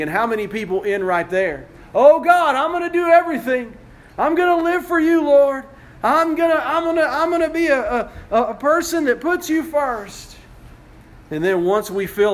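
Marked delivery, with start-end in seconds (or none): 1.9-10.4 high, fast, earnest pledge to God; fervent pleading vow. Retold or imitated. A believer vowing to God in an excited rush. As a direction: gush promises like a new convert.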